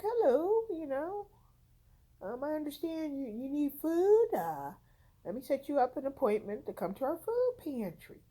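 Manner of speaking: put-on a light, high-pitched Spanish-accented caller. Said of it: "He has a, a Spanish accent".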